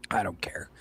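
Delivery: breathed out under the voice, barely there; dismissive whisper. Real, not acted. To the co-host about lazy readers, a dismissive whisper. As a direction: whisper it like you've already stopped caring.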